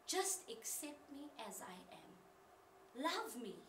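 very soft, slow pleading; tender plea. Acted. Voicing her sisters' unspoken wish for love, tender plea. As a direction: plead gently, barely voiced.

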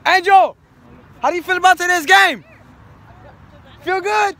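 high, loud call. Real, not acted. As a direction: call across the field. Calling out to player.

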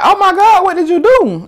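high, loud shocked cry; shocked gasp. Retold or imitated. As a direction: blurt it out, stunned. Retelling her shocked reaction.

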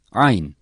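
Ayn squeezed from mid-throat; Arabic ayn demo; throaty Ayn. Shown as a demonstration. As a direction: press Ayn out of a tightened throat. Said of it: "Boğazın ortasından boğaz biraz sıkılarak".

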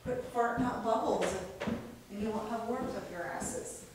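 usual speaking voice

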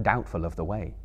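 usual speaking voice

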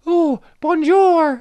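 very high, slow mock French; bad French accent. Shown as a demonstration. Doing French on request, high slow hammed-up French accent. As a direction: stretch out bonjour like a cartoon Frenchman.

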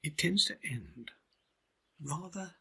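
dropping to a soft hush; teasing listeners with a hushed secret. Shown as a demonstration. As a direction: lean in and whisper the ending. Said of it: "let me tell you a little secret".

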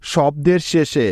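শব্দের শেষে said slowly and deliberately; teacher stressing শব্দের শেষে; slow emphasis. Shown as a demonstration. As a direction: lean on শব্দের, drawn out. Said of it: "ভালো করে লক্ষ্য করো".

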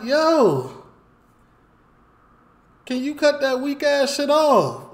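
loud, high yell at the neighbor. Retold or imitated. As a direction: shout it down from the balcony, high-pitched. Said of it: "I yell down".

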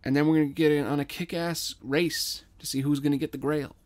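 ordinary delivery, nothing marked

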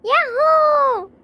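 high, long-drawn whoop; gleeful cheer. Acted. Sister celebrating her deal with brother, gleeful cheer.

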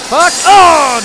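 loud, high burst of rage; frustrated yell. Real, not acted. Gamer killed by the game, frustrated yell. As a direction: yell out at yet another failure.

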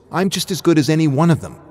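defiant outburst. Acted. Min defends her gaming dream.

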